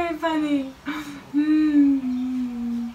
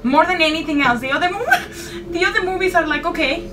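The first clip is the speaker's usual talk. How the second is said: nervous laughing; 6 semitones higher, 4 dB louder, faster than usual. Real, not acted. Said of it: "I'm getting chills".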